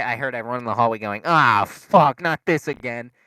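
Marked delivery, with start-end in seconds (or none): 1.2-3.1 louder, higher fed-up groan; imitated exasperated outburst. Students hearing the alarm return, mock exasperated cry. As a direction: mimic a hallway full of annoyed students. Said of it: "everyone got really pi".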